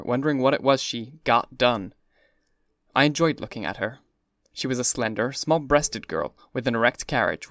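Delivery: ordinary delivery, nothing marked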